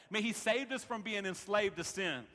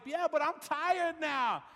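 put-on high, drawn-out kid complaint; mock childish protest, pitched way up; imitating tired daughter; 10 semitones higher, 4 dB louder, slower than usual. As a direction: whine it slowly as a worn-out child. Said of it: "And she's like".

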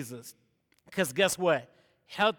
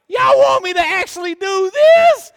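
much higher and louder, mock panic; panicked high cry; 12 semitones higher, 14 dB louder than usual. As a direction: yelp it in hammed-up fear. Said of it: "and I'm like".

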